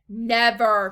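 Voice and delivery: loud, emphatic exclamation; cried out. A narrator's reading. Wonka rejects the mother's fear, cried out. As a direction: refuse it with a loud cry. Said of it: "cried Mister Wonka".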